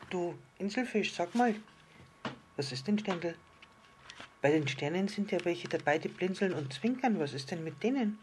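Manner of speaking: inquisitive little star. Acted. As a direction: ask it as a small, curious star.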